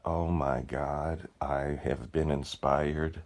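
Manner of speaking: slow, soft, awestruck retelling of an epiphany; hushed awe. Retold or imitated. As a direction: breathe it out like a revelation. Retelling his own moment of inspiration.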